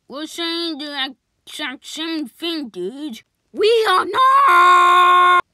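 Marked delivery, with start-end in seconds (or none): 3.5-5.4 very loud, high, slow protest; shouted denial. Acted. Arguing with a friend over the name, shouted denial. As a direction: stretch it out into a yell.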